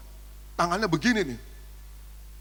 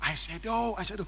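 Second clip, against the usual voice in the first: whisper; 2 semitones higher, 6 dB quieter than usual. Retold or imitated. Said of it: "Saya bisikin".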